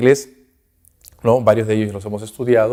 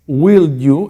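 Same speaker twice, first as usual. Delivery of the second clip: less polite; 5 semitones higher, 5 dB louder than usual. Shown as a demonstration. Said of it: "es menos cortés".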